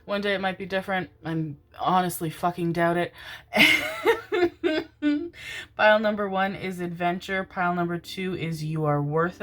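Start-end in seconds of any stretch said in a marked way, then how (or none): 3.2-5.7 long, high giggle; amused giggle. Real, not acted. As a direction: giggle at your own remark, up high. Laughs at own doubting remark.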